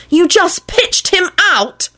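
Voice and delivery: high, drawn-out accusation without much volume; accusing scream. A narrator's reading. Woman confronting the man over the graveyard, accusing mock scream. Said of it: "Elizabeth screamed accusingly at Quentin".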